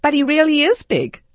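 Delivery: high, booming retort, imitated; very loud voice. Teenager answers his mom in the checkout line, very loud. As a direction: blurt it out at full volume. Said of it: "in a very loud voice".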